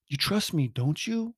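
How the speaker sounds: wheedling puppy-eyed plea; puppy dog eyes. Retold or imitated. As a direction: beg like a sad puppy. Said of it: "With, like, these puppy dog eyes".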